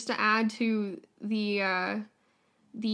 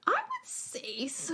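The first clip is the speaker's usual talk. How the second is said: whisper; 12 semitones higher, 4 dB quieter, slower than usual. Acted. Teasing answer to co-host.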